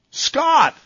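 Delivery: high, loud greeting call. Real, not acted. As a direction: call the caller's name brightly. Host calls caller on air.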